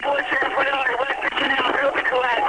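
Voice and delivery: high, strained urgent radio call; urgent, frantic. Real, not acted. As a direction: push the message out in alarm. Urgent priority call after collapse.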